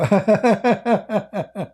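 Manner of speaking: high cackling imitation laugh; mock nervous laugh. Imitating the vice president's evasive laugh, high cackle. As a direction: laugh to cover, imitating her. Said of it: "she laughed just to cover".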